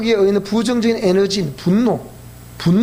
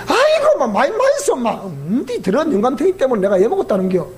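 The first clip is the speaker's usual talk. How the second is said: put-on Gyeongsang dialect old woman. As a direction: complain like a Gyeongsang grandmother. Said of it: "경상도 버전으로 얘기하지요".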